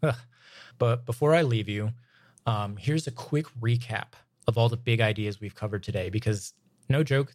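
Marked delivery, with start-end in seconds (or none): none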